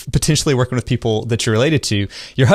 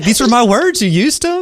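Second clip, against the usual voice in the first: mock indignant sister; 11 semitones higher than usual. Mocking sister's claim to words.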